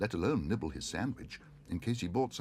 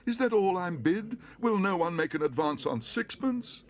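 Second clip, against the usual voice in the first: high, loud desperate pleading; desperate auctioneer; 11 semitones higher, 6 dB louder than usual. A narrator's reading. Auctioneer pleads for a higher bid. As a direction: beg the room for a bid.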